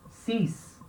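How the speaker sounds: cis said with a clear s, like sees; teacher says cis with an s sound; french six. Shown as a demonstration. Teacher models the French number six for students. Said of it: "Esse X vai ter som de S".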